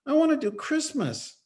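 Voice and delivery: put-on high small-boy plea; child pleading. Son begging his dad for Christmas, high voice. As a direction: plead like a little kid.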